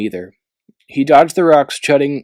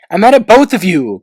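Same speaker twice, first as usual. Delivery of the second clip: higher, louder cross outburst; 9 semitones higher, 7 dB louder than usual. A narrator's reading. Clay stomping away from Glory and Tsunami, cross. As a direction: storm off saying it. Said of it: "Clay stomped over".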